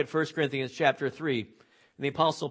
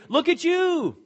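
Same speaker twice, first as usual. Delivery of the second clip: mocking; 12 semitones higher, 5 dB louder, slower than usual. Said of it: "He's saying here".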